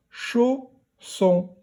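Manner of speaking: chausson cut into "chau", pause, "sson"; chausson, a clear gap between syllables. Shown as a demonstration. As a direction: split chausson into two separate high syllables. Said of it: "première syllabe".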